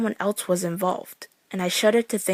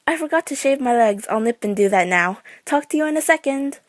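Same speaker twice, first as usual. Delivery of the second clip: higher forced breezy tone; fake casual voice; 6 semitones higher, 4 dB louder than usual. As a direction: bluff a casual phone call. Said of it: "My voice was too high-pitched and fake".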